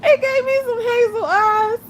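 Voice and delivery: high, giggling through the words; cracking up while describing the eyes; laughing. Real, not acted. Laughing about the filter.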